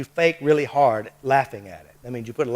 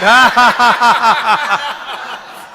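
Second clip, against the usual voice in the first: long, high, loud forced laughter; loud fake laugh; 9 semitones higher, 11 dB louder than usual. Shown as a demonstration. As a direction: push a big effortful fake laugh out. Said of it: "Now laugh at it".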